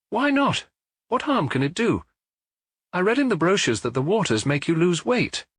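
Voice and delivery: high, light wifely voice; Madame Maigret voice. Acted. Wife defending taking the waters to Maigret, high light voice. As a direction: lift the voice up for the wife.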